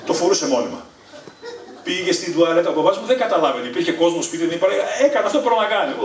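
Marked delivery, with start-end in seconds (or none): none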